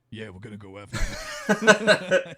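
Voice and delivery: slow, loud gruff hero impression; Wolverine voice. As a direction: play it big and tough, laughing through it. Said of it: "Wolverine's hand, it's just like".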